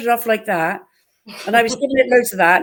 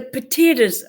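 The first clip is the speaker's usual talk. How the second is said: imitating an exaggerated Irish "potatoes", pitched higher; Irish-accent attempt; 4 semitones higher, slower than usual. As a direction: say "potatoes" as "puh-TEE-ders" in a put-on Irish accent. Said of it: "it was the way I said potatoes".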